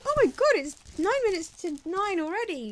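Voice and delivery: high, loud re-enacted panic; sudden high-pitched fluster at the time; mild panic. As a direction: blurt it out in mock alarm. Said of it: "I just had a mild panic going".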